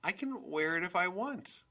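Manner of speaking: put-on high-pitched child talking back; high, defiant whine of a kid; defiant protest. A narrator's reading. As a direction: protest like a stubborn boy. Boy talks back to mother.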